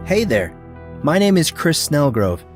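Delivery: ordinary delivery, nothing marked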